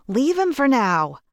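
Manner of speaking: shouting. A narrator's reading. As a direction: yell it to drag her away. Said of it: "as T J shouted".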